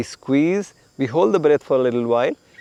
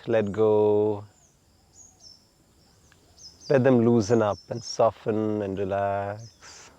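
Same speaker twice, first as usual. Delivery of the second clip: guided relaxation. Acted. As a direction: talk them gently into letting go.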